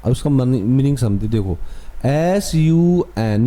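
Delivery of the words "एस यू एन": letters spelled out very slowly; slow spelling. Shown as a demonstration. Teacher spelling a word for students, very slow. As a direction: dictate each letter, drawn out. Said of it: "ये हो गया सन".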